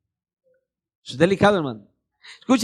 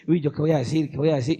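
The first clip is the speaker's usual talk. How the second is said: lower, softer fretting; mock worry; 7 semitones lower, 5 dB quieter, faster than usual. Believer fretting over what to say, lower and softer. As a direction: mutter like someone anxious.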